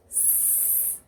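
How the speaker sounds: "s" as a long voiceless hiss; som de S. Shown as a demonstration. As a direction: hold a soft sss for "s". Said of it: "Se tiver o som". ("s" como chiado prolongado)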